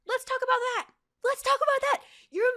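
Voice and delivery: outraged. Real, not acted. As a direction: spit it out in disbelief. Ranting at Zuko.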